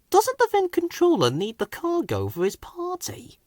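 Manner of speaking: puzzled thought. A narrator's reading. Colin puzzling over the cargo, high and soft. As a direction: wonder it quietly to yourself. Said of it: "he thought".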